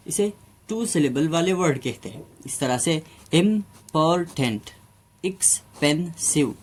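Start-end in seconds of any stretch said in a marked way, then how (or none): 3.3-4.6 "important" को "इम… पोर… tent" तोड़कर; "इम्पोर्टेंट" के हर सिलेबल पर रुकें; तीन हिस्सों में. Shown as a demonstration. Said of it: "इसे हमने तीन हिस्सों में बोला". 5.3-6.6 "एक्सपेंसिव" धीरे: "एक्स… पेन… सिव"; "एक्सपेंसिव" के हर सिलेबल के बाद छोटा ठहराव; तीन हिस्सों में. Shown as a demonstration. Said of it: "इसे हमने तीन हिस्सों में बोला".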